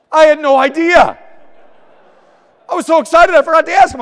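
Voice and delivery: bursting with excitement; so excited. Retold or imitated. Husband telling of the call to his wife, excited.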